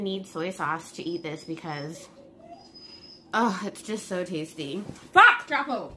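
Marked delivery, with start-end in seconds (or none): 5.1-5.9 loud, high startled swear; sudden loud curse. Real, not acted. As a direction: blurt it out sharply. Sudden outburst mid-meal.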